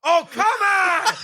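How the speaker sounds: high, drawn-out, laughing mock outrage; exasperated fan in the theater, hammed-up; mock yell at the screen. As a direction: howl it like a fan let down by the movie. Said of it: "I was just yelling at the screen".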